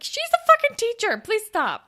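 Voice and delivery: high-pitched, exasperated begging; exasperated plea. Acted. Viewer begging a character to stop, exasperated.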